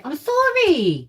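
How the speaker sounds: high, drawn-out cooed apology; soothing pet talk. Real, not acted. Owner apologising to dog after yelling, cooing high. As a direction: coo an apology to a startled pet.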